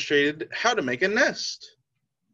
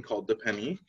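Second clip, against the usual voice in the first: usual speaking voice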